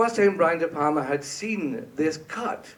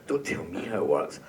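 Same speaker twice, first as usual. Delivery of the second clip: much lower, gruff mock director; Brian impression; 10 semitones lower, 4 dB quieter than usual. Director waving off the composer, in a low mock growl. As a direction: drop the voice way down like a boss. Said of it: "excuse my terrible Brian impersonation".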